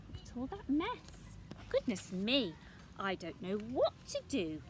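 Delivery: plain reading voice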